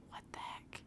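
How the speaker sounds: whispered in disbelief; hushed shock at the news; stunned whisper. Retold or imitated. Reacting to surprise gift.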